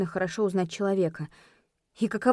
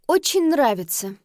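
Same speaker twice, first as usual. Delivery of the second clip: sugary, higher sweet reply; sweetly; 5 semitones higher, 5 dB louder than usual. Acted. Wife replying to her teasing husband, sweetly and a bit higher. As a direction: answer with a sweet smile that stings. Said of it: "мило улыбнулась я".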